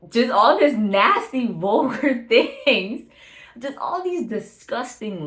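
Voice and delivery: loud, high, slow mock-disgusted outburst with a laugh. Real, not acted. Disgust at vulgar lyrics.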